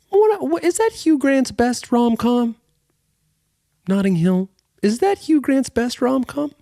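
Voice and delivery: high-pitched incredulous squeak; mock astonishment. Interrupting over Notting Hill, high mock-astonished squeak. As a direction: squeak the question in exaggerated disbelief.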